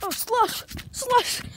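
high, loud squeal of disgust; startled yelp. Real, not acted. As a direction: yelp at the icy slush. Slush in the snow.

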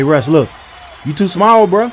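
ordinary talk, nothing marked

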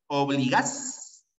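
"Obligas" slowed, leaning on GAS; slow "Obligas" with the force on GAS; stressed syllable demo. Shown as a demonstration. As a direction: draw out "Obligas" to its strong last syllable, o-bli-GAS. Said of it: "eh, la fuerza".

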